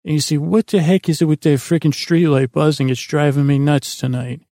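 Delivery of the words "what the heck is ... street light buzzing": ordinary talk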